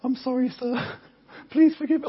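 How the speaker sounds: high-pitched, pleading apology; hammed-up terrified begging; mock terrified. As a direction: plead like a frightened traveller to an officer.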